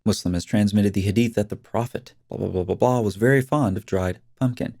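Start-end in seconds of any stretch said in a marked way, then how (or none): none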